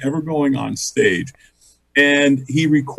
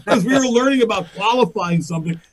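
normal talking voice

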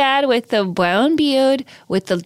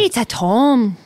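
mock Irish copy of the host; imitated Irish accent; slower than usual. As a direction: mimic "tome" in an Irish accent. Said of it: "That was closer".

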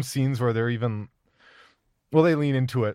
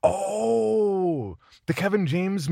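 surprised; 10 semitones higher, much slower than usual. Real, not acted. Suddenly recognizes the Becky movie.

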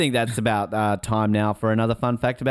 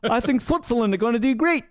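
much higher mock bro on the phone; mock Kiwi mate; 9 semitones higher than usual. Mocking co-host's secret phone source, high hammed-up bro voice. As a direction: ham up a Kiwi mate giving tips. Voicing Colin's phone contact.

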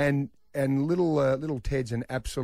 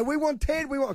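put-on high, whiny kids begging; kids whining; 12 semitones higher than usual. Dad imitating the kids' demand, high and whiny. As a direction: mimic the kids chanting for Ted. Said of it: "And they go".

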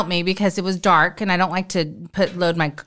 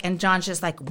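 normal talking voice

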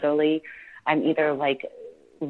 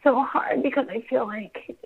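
ordinary delivery

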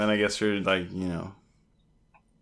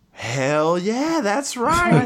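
hammed-up gung-ho cheer, much higher and louder; mock cheer; 12 semitones higher, 6 dB louder, much slower than usual. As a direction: crew cheering the risky plan, hyped. Crew cheering the plan.

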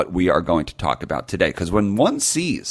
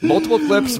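Multiple pitched way up, insistent; high emphatic repeat; 13 semitones higher, 3 dB louder than usual. Real, not acted. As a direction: push Multiple up high, incredulous. Repeats much higher, emphatic.

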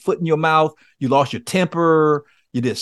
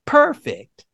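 perfect; 12 semitones higher than usual. Retold or imitated. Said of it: "He says".

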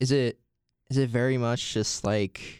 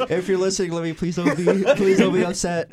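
laughing; 8 semitones higher, 6 dB louder than usual. Real, not acted. Laughing plea to the dog.

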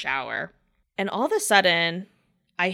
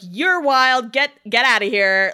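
firm dismissal; 5 semitones higher, 7 dB louder than usual. Retold or imitated. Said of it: "She was like".

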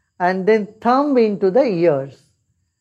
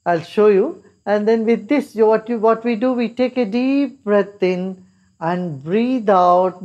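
ordinary delivery

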